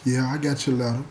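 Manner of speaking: low, soft, defeated reply; somber voice. Retold or imitated. Inmate answering girlfriend on phone, somber and soft. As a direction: answer her heavy and quiet. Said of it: "talking in a real somber voice".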